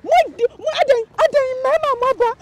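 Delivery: rapid high scolding; fast loud scolding. Acted. Scolding the men who came, fast and loud. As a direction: rattle off the telling-off. Heated mock argument.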